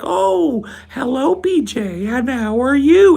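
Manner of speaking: high, drawn-out syrupy greeting; sugary polite host. Retold or imitated. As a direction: gush over the guest like an overly sweet parent. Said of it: "they go like".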